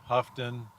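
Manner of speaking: Houghton said HUFF-tun; alternate pronunciation. Real, not acted. Correcting the town's name, Houghton as HUFF-tun. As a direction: say Houghton with a short U and an F. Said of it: "Let's just pronounce everything wrong".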